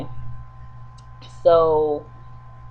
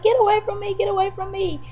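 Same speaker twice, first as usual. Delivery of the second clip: imitated screaming; 14 semitones higher than usual. Woman flees him through the house, high mock screaming. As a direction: mimic panicked cries without full volume. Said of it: "she's screaming and hollering".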